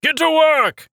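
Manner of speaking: very high, loud barked command; shouted order. A narrator's reading. Acolyte ordering Rowan to work, very high loud shout. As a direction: bark the order. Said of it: "shouted the acolyte".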